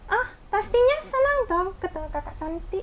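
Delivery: high squeaky puppet voice; ventriloquist puppet voice. Pretend. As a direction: talk as the puppet, lips still. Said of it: "Jadi Trixie di sini senang nggak?". (suara boneka ventriloquist)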